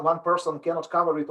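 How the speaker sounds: ordinary talk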